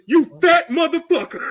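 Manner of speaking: loud, high outburst with laughter; hammed-up shouted roast; comedian's insult rant. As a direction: yell it in mock outrage, half laughing. Imitates comedian ripping on people.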